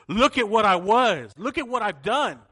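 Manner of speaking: loud, high, swooping proclamation; impassioned testimony. Acted. Preacher showing everyone the turned-over cushion, impassioned testimony. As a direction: proclaim it to the whole room.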